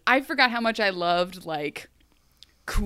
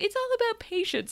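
much higher, soft, sugary mock voice; sing-song it sweetly to mock her; mock sweet Ingrid; 13 semitones higher, 3 dB quieter than usual. Mocking Ingrid's patience line, high and sugary.

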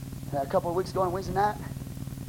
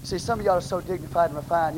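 ordinary talk, nothing marked

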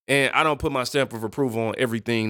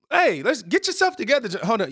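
higher-pitched mock self-scolding; talking to himself; 9 semitones higher than usual. Host pulling himself into a meeting, mock scolding. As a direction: call yourself to order, pitched up. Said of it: "can I have a meeting with myself".

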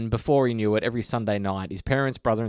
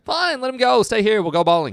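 high, loud imitation of Hank Scorpio; Hank Scorpio impression; 11 semitones higher, 6 dB louder than usual. Quoting a favourite Simpsons line to a co-host, Hank Scorpio impression. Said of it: "It's just the way he says it".

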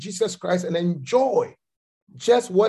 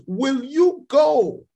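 slow preaching cry; 6 semitones higher, much slower than usual. Acted. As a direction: stretch each word out as a demand. Preacher's altar call, rising appeal.

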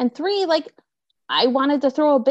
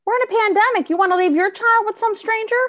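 high, indignant challenge; worked-up, pitched-up retort; incredulous outrage; 11 semitones higher, 4 dB louder, faster than usual. Retold or imitated. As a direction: argue back in disbelief. Retold argument over childcare.

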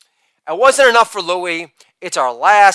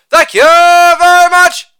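high, loud, sustained catchphrase; showman's ringing thank-you; showy sign-off; 11 semitones higher, 8 dB louder than usual. Acted. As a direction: belt it out on one held high note.

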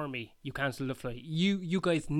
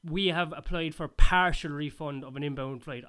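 mock reading the email; 8 dB louder than usual. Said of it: "you just sent me an email saying".

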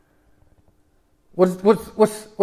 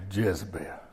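ominous Jezebel; 11 semitones lower, 15 dB quieter than usual. Shown as a demonstration. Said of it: "You can't just say Jezebel".

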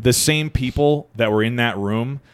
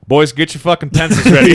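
loud, pitched-up call to the room; brash announcement; 4 semitones higher, 7 dB louder than usual. Retold or imitated. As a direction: announce it big, as if rallying the boys. Acted announcement of a confession.

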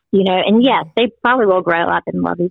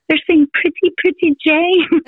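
high, slow singsong; singsong bird words; 10 semitones higher than usual. Retold or imitated. Mom telling little girl what birds sing, high slow singsong. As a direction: lilt it like a mother cooing a birdsong to her girl.

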